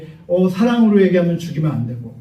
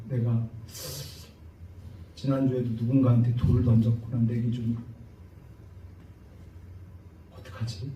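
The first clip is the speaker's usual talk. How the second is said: quiet remorseful inner voice; 6 semitones lower, 10 dB quieter than usual. Retold or imitated. Inner remorse, realizing his sin.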